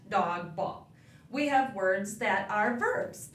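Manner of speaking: ordinary delivery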